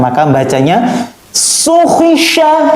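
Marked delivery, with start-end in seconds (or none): 1.3-2.7 high, crisp Quran letters; fast, firm recitation. Shown as a demonstration. Teacher shows pupils fast, firm letter reading, high and crisp. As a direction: snap out each syllable firmly. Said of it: "Nah, harus gitu".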